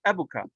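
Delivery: abuka with a brief u, no lengthening; short-vowel form. Shown as a demonstration. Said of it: "like any other noun, it would be".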